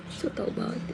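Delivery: whispered teasing aside; whispering. Real, not acted. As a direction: breathe it under your breath. Muttered aside to herself.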